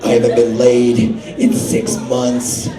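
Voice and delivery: very low, slow slithering voice; snake voice. Retold or imitated. Imitating Dave as a snake, deep and slow. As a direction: drag it out low like a stoned snake. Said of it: "He's just".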